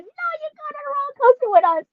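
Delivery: high, fast insistent mother; mother insisting her child rides along; firm mom. Retold or imitated. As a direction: lay down the law like mom. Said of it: "my mom was like".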